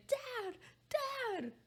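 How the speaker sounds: put-on high, soft child calling Dad; little kid voice. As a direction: call out like a small kid. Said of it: "him saying".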